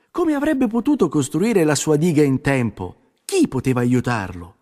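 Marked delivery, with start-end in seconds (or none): none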